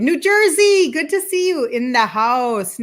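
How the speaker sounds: high, loud call of "New Jersey"; light up at the name; excited shout-out. Real, not acted. Host spotting a New Jersey viewer, excited.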